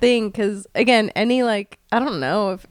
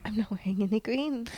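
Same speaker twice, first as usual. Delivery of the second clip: hushed, voiceless confession; whisper; 13 dB quieter than usual. Real, not acted. Confessing to cohost, urgent whisper. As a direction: whisper it like a guilty secret. Realizes no green on St Patrick's.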